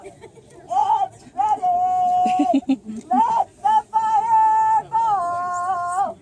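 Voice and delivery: huge, high, drawn-out ceremonial holler; yelled reply. Acted. Answering from the clifftop to start the fireball, drawn-out yell. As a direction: bellow it down from the heights, holding each word. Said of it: "he'd yell back down".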